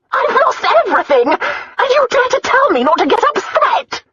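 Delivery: very high, loud, fast screech. A narrator's reading. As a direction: screech it in quick outrage. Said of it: "the Countess screeched".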